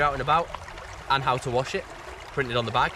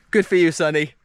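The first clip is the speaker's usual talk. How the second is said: loud mock cheer; 4 semitones higher, 7 dB louder than usual. Real, not acted. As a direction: call out to Sonny, louder.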